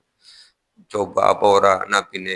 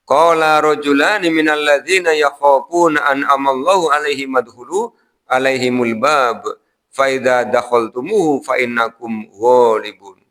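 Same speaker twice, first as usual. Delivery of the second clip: Quranic recitation; 3 semitones higher, 3 dB louder than usual. Acted. Quran verse recited in sermon.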